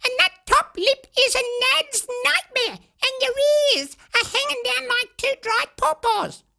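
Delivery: angry. Acted. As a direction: rant at the wolf indignantly. Hoodie telling off wolf.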